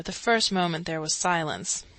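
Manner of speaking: ordinary delivery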